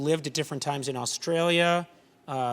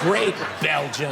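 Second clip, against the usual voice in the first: slow, mock-impressed put-down; mock reaction; slower than usual. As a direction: drag it out with feigned approval. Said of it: "And you're like".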